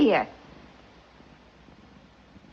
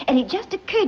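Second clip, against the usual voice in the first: usual speaking voice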